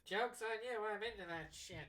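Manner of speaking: high-pitched cartoon villain impression; Skeletor. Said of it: "Fuck off, Skeletor".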